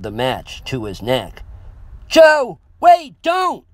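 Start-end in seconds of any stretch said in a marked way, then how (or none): 2.1-3.6 very high, loud panicked bark; barked shout. A narrator's reading. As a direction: bark it to stop him. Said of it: "I barked at him".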